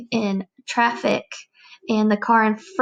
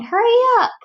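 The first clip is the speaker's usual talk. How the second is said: high, louder mock shout; impatient yell; 11 semitones higher, 5 dB louder, faster than usual. Teacher describing her drawing, yelling at the car, high and loud. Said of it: "and I was saying".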